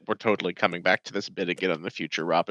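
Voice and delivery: low confiding aside; quiet aside. Real, not acted. Aside to Robin.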